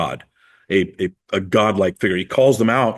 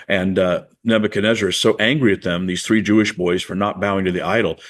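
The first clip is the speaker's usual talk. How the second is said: ordinary delivery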